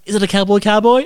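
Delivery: high, loud amazed question; astonished. Retold or imitated. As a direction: gasp it out, amazed. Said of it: "and I'm like".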